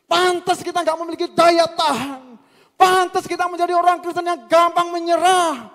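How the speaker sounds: loud, high preaching cry; impassioned rebuke. Real, not acted. Preacher reproaching congregation for weak faith, impassioned shouting. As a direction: hammer each repeated opening at full force.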